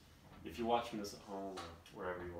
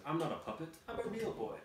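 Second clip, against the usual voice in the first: put-on high, loud childlike protest; Pinocchio; 8 semitones higher, 5 dB louder than usual. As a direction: insist like an indignant puppet boy. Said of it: "Pinocchio exclaims".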